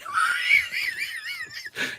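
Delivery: high shrieking burst of laughter; shrill helpless laughter. Real, not acted. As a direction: cracks up, losing it. Laughs at own bit.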